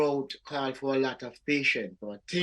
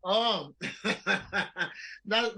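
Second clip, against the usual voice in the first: amused, higher-pitched laugh on the words; 4 semitones higher than usual. Real, not acted. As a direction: laugh before answering. Guest amused by doubters question.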